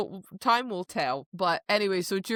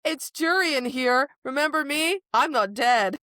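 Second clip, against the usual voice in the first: higher, louder cocky villain voice; voicing Jurian; 7 semitones higher, 5 dB louder than usual. Podcast host voicing the returning villain, mock cocky. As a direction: swaggers in, pleased to be back.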